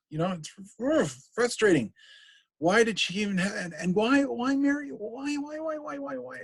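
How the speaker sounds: Hamlet despairing over his mother's weakness; exasperated Hamlet. Acted. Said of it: "First soliloquy".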